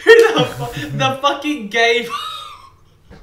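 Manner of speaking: high, loud yell through laughter; gleeful mock shout. Cracking up over the conspiracy joke, laughing high shout. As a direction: shriek it and drag out the last word slowly.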